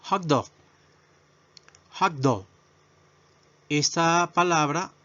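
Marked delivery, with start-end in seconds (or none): 0.0-2.4 hot dog said as "jak do", hot punched; model pronunciation. Shown as a demonstration. Teacher drilling hot dog with the class, clear model. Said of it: "pronunciación".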